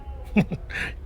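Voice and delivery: short breathy gloating laugh; mocking chuckle. Rival gloating that it's over, mocking chuckle. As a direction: laugh at him under your breath.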